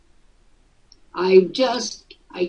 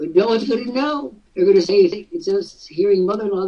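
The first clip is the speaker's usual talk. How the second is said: ordinary talk, nothing marked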